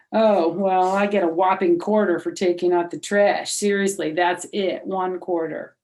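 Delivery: put-on a boy griping over his measly allowance; complaining boy. Lenny complains about allowance.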